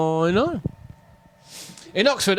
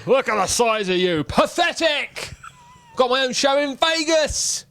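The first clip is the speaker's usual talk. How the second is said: higher, scoffing boast; mock scorn; 7 semitones higher than usual. Vegas lion watching the Aristocats, scornful and boastful. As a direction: sneer it with showy disdain.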